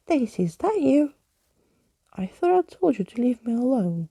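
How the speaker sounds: put-on a startled old skeleton bumping into her; high, loud, surprised skeleton character; skeleton's surprised voice. A narrator's reading. Surprised skeleton meets Daisy.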